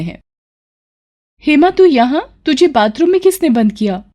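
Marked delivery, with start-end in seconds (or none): 1.4-4.0 high, startled question; surprised sister. Acted. Sister finds bride locked in bathroom, high and startled. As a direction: sound shocked to find her there.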